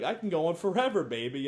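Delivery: hammed-up cocky brag; cocky boast. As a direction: brag like a smug ladies' man. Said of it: "the guy's like".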